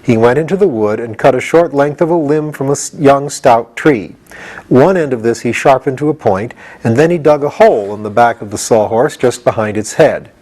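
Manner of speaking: plain reading voice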